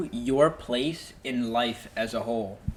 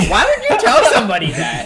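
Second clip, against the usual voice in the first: laughing; 10 semitones higher, 9 dB louder than usual. Real, not acted. Laughing at a friend's confession.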